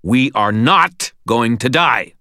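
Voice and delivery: emphatic, angry insistence; snarled. A narrator's reading. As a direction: snarl it slowly, leaning hard on each word. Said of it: "I snarled".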